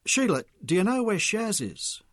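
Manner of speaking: agitated. A narrator's reading. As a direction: demand an answer, worked up. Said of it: "Mick was agitated".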